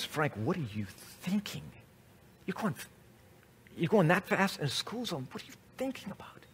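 judge at the bench, dropping to a near whisper; a judge's private, hushed scolding. Retold or imitated. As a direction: lean in and hush it so no one hears.

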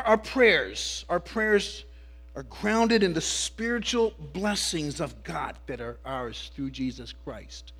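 ordinary talk

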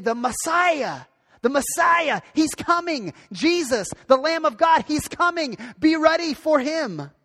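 high, urgent proclamation; John the Baptist yelling. Acted. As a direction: herald the news, calling out each phrase. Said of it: "John the Baptist yelling and saying".

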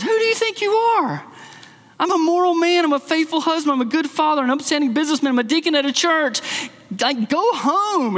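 high-pitched indignant retort; mock indignation, pitched well up; irritated dad. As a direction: act out an offended father telling someone off. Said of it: "And the dad gets irritated".

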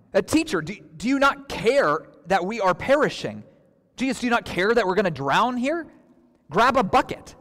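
panicked, pleading with Jesus over the storm; frustrated desperate plea. Retold or imitated. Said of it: "This is just how a frustrated and desperate person sounds".

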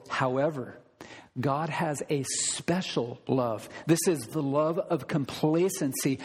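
ordinary talk, nothing marked

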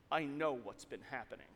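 low, hushed and ominous boss; imitated solemn boss, soft and deep; grave accusation. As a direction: quietly let them know you know, pitched low. Boss's grave accusation, acted.

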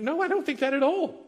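indignant denial. Retold or imitated. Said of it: "you're like".

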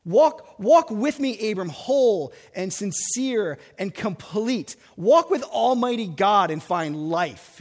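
loud, slow, fervent call; God's loud invitation to walk with him. Retold or imitated. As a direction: proclaim it loudly as God calling Abram. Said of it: "he says to Abram".

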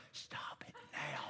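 hushed, slow whispered scolding; imitated wife's whisper. Wife tells the preacher to stop, imitated whisper. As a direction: whisper it slowly as an imitated aside. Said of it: "My wife's like".

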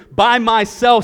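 emphatic oath. Acted. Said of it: "says the Lord".

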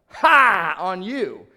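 loud scornful "Ha" thrown out; mocking taunt. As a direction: jeer loudly, then drop back. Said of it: "it's a tone of mockery and taunting".

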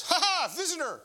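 put-on a five-year-old's high, loud squeal of delight; excited little boy. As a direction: squeal it like a thrilled kid opening the door. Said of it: "he goes".

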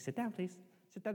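soft polite request; said nicely. Shown as a demonstration. Speaker asking politely, soft. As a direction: ask gently to sit. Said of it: "say things nicely".

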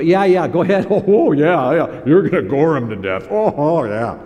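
sarcastic, mock-eager yeah yeah; mock the false prophets with a laugh; facetious. Micaiah mocking the king's prophets, sarcastic and loud. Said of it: "in a facetious way".

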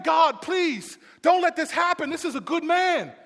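high, urgent plea to God; pleading prayer. Acted. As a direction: beg God as the distressed follower. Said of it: "he's praying".

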